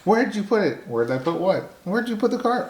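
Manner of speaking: high imitation of a puzzled wife; husband mimicking his confused wife. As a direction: ask it in a high mock-puzzled voice. Husband voices wife's confusion.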